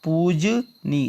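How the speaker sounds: पूजनीय drawn out slowly, each syllable clear; slow correct form. Shown as a demonstration. Teacher slowly dictates पूजनीय to the class. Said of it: "क्या होता है?".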